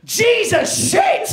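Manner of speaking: slow, high, loud shocked shriek; shocked shout. Retold or imitated. Woman finding a man on the toilet, shocked shriek. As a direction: shriek it in horror. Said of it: "the best reaction I've ever heard".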